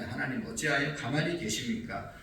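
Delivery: ordinary talk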